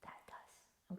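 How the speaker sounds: whispered contrast; soft unvoiced "that does". Shown as a demonstration. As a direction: hush it like a secret. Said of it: "this doesn't make you feel good".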